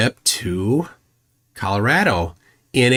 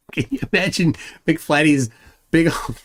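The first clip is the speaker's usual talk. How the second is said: laughing; 7 semitones higher than usual. Real, not acted. Laughing at McFlatty idea.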